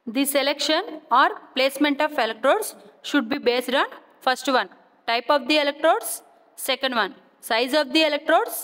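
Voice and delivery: reading notes out slowly, phrase by phrase; slow dictation. Shown as a demonstration. Teacher dictating electrode notes to physio students, slow and measured.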